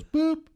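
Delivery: high, loud beep noise mouthed; imitated machine beep. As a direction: chirp it like an answering machine.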